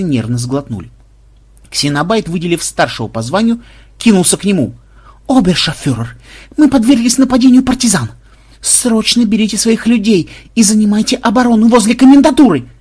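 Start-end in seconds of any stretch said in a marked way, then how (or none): 5.3-12.7 high, loud, agitated report; urgent alarm. Acted. Disguised programmer reporting partisan attack to officer, urgent. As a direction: raise the alarm with dramatic urgency.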